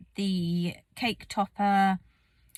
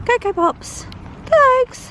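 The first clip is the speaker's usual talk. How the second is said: high, loud call across the field; 10 dB louder, slower than usual. Real, not acted. Calling her horse Cocoa from across the field, loud and high. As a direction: shout her name to fetch her in.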